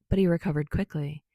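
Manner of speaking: ordinary delivery, nothing marked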